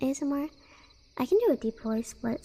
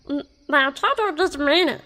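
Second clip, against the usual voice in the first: Meatwad; 2 semitones higher, 8 dB louder than usual. Acted. Streamer trying out the Meatwad voice for viewers, loud cartoon impression. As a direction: belt it out in a put-on cartoon voice. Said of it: "I need to do meat wad voice".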